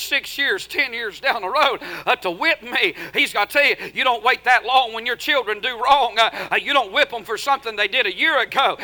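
usual speaking voice

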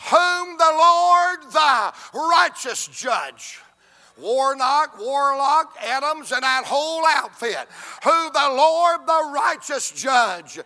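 loud, drawn-out pulpit cadence; fervent revival preacher shouting; full-force preaching. Acted. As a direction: preach it, rising and pounding.

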